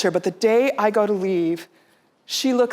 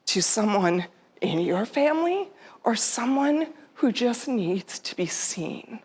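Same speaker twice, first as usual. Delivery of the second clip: guided meditation; 4 dB quieter than usual. Acted. Speaker guiding the audience, softly meditative. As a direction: lead them gently into breathing.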